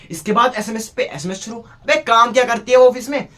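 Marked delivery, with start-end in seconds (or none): 1.6-3.3 loud, higher burst of disbelief; exasperated outburst. Acted. Exasperated outburst at endless messages. (झल्लाकर ऊँची आवाज़ में)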